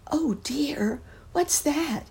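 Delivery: put-on high whispered frightened child; a little boy jumping at a sound; high hushed fright; startled lost boy. A narrator's reading. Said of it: "exclaimed the lost boy".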